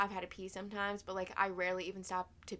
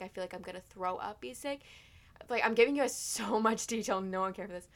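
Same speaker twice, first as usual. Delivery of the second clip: normal talking voice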